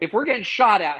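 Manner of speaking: retold angry scolding. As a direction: replay the instructor's chewing-out, half-acted. Harsh training officer scolding rookie.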